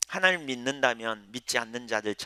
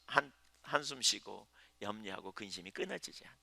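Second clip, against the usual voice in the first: ordinary talk, nothing marked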